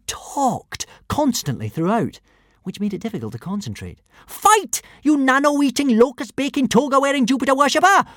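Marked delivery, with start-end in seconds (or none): 4.3-8.0 put-on high, loud little-girl battle taunt; fighting taunt. A narrator's reading. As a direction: rattle out insults fiercely in a high voice.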